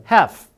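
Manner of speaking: firm model of "Have", L dropped, falling at the end; silent L. Shown as a demonstration. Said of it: "이렇게 발음하면 되고요".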